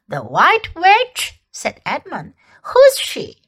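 slow, rising then high question; surprised boy. A narrator's reading. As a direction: feign surprise. Edmund surprised.

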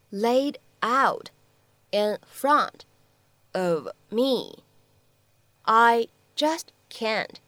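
slow model reading with long pauses between word groups; slow chunked reading. Shown as a demonstration. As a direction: read it out piece by piece, very slowly. Model sentence read slowly in chunks.

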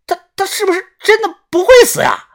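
高声慌张惊叫，带点结巴; 吓坏了似的尖着嗓子喊. A narrator's reading. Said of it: "惊呼道". (又高又急地惊恐大喊)

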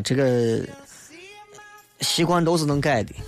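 usual speaking voice

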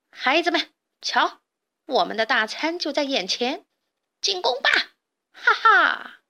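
high-pitched villainous rallying cry; rally the gang, then cackle; gloating bandit chief. A narrator's reading. Bandit chief orders the attack.

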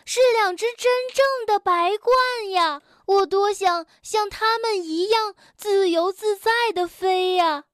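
high, loud longing to fly; dreamy, yearning amazement; wistful wonder. A narrator's reading. Frog wishing he could fly like the storks. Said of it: "跳跳惊叹道".

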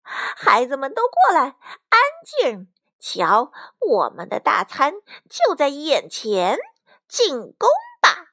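loud, swooping bandit-chief voice; weird gloating villain. A narrator's reading. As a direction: command the gang with wicked relish. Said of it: "强盗巴巴怪声笑道". (强盗头子怪声怪气地发令)

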